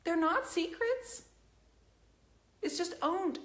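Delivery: They're not secrets, said slowly with not rising; slow and deliberate. Real, not acted. Repeating it slowly to the whole chat. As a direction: spell it out slowly, word by word.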